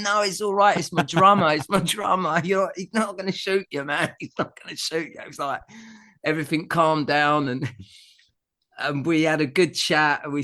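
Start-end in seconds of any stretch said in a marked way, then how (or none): 0.0-5.2 mock panicked reassurance, pitched higher; retold panic. To Noel at the car window, imitating panicked calming. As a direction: hurry to calm everyone, half-laughing. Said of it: "Everyone's freaking out".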